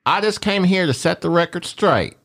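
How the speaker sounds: high, mocking girly voice; mock impression of S0. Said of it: "Does that sound better?".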